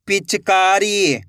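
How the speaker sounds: slow, stretched reading of the whole word; joined-up word. Shown as a demonstration. Teacher showing learners the finished word, slowly joined up. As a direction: blend the syllables into one drawn-out word. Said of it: "तो मिलके बन जाएगा".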